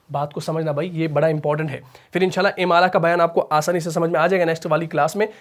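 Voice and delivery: normal talking voice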